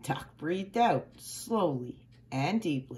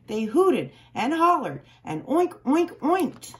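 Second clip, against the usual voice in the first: high, loud hollering and oinking; rowdy pigs; 7 semitones higher, 5 dB louder than usual. A narrator's reading. Narrator voicing excited pigs hooting and oinking.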